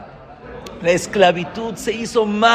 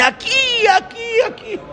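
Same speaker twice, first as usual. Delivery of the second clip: very high, excited outburst; sudden exclamation. Retold or imitated. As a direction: leap up high as if suddenly spotting the place. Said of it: "de repente él empieza y dice".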